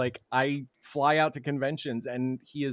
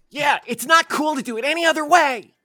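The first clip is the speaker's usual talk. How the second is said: put-on squeaky high anime girl voice; high cute anime girl; 12 semitones higher, 7 dB louder than usual. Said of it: "Just, just do it with an anime voice".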